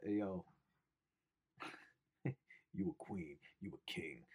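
a lightly voiced preachy hotep, dropping soft; hotep impression. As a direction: lay the flattery on like a street preacher. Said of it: "sounds like one of them hoteps".

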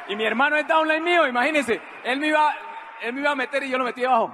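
loud, high, excited aside; gleeful crowing. Real, not acted. Telling audience his brother is downline, gleeful crowing. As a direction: crow loudly over the reversal.

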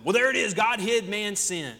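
ordinary talk

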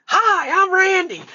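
high, loud mock holler from a stranger; sudden shouted greeting. Dude jumps up at the worker, a loud high shout. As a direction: burst out with a startling, high-pitched hello.

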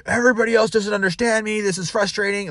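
high-pitched imitation of a complainer; mock complaint. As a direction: complain like a put-upon employee, high voice. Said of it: "they have some complaint about something".

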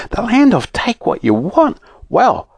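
high-pitched, thrilled wonder; excited. A narrator's reading. Fanny reacting to the letter, excited. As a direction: marvel at it in a soaring voice.

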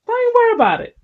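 quick, high brush-off; breezy catchphrase. Shown as a demonstration. Man repeating his own line, high and breezy. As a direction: toss it away high and light. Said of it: "That's my line".

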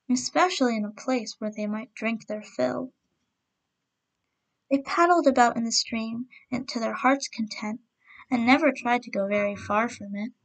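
ordinary delivery, nothing marked

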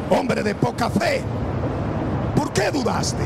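high, loud gentle rebuke; Jesus rebuking. Acted. Jesus rebukes Peter for his little faith. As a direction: chide him for doubting.

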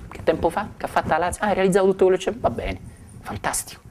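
a lightly voiced scoffer brushing it off; mock indifference. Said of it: "E è in silenzio davanti". (scettico che minimizza con sufficienza)

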